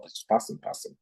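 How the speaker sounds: breathy whisper, voice dropping away; mock whispered aside. Teachers waving students through, whispered mockingly. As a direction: hush it like a throwaway. Mimicking teachers' rote attitude.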